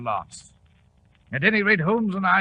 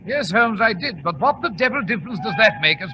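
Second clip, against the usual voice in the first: louder, calling over the rumble of the train; raised voice; 5 semitones higher, 5 dB louder than usual. Acted. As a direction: push the voice over the train noise. Watson over the noise of the train.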